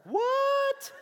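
high, loud shocked squeal; wife hearing the news on the phone, shocked. Retold or imitated. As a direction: squeak it out in disbelief. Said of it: "And she's like".

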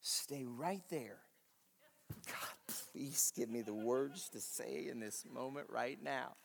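slow, soft whispered prayer. Acted. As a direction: breathe a secret prayer under your breath. Acting Nehemiah's silent prayer.

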